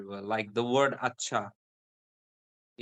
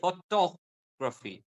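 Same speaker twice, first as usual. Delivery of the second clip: "Photography" drawn out slowly, higher pitch on "to"; stress on "to"; 4 semitones higher, slower than usual. Shown as a demonstration. Teacher explains the stress point to a student, emphatic "to" in "Photography". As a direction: stretch the "to" in "Photography". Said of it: "You stress on to".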